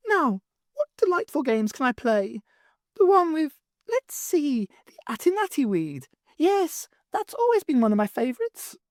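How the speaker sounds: high, impish goblin voice; gleeful goblin. Acted. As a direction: savour each game, impishly. Goblin Sly gloating.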